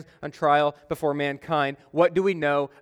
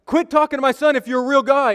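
higher, louder challenge into the earpiece; mock demanding; 10 semitones higher, 7 dB louder than usual. Preacher grabs the earpiece and challenges the caller, loud. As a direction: demand the caller prove he's real. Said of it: "I was like".

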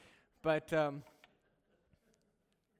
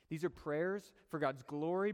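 normal talking voice

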